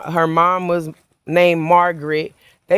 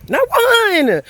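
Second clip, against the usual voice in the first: sharply higher, emphatic denial; 13 semitones higher than usual. Real, not acted. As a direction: hit it hard and high, no doubt. Insists no righteous person exists.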